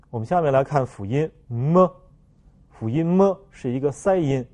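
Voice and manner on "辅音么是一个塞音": ordinary talk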